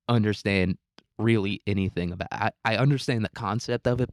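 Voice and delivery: usual speaking voice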